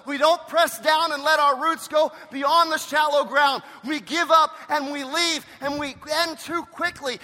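loud, driving sermon cadence, climbing high; preaching at full force. Acted. Pastor urging his congregation to persevere, preaching loud and high. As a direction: preach it hard and press the point home.

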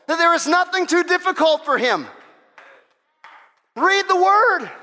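high, loud, insistent exhortation; preaching at full force. Acted. Preacher urging the church to read the word, loud. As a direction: drive each line home to the crowd.

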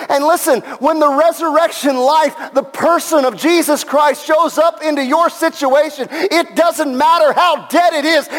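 high-pitched, full-force sermon delivery; impassioned preaching. Real, not acted. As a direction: preach it with rising fervour to a shouted peak.

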